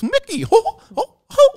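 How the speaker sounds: high, loud cartoon falsetto; Mickey Mouse. Showing the voice from a Disney trip, high Mickey voice. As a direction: squeak it out with bouncy little whoops. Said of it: "so I went to Disney last year".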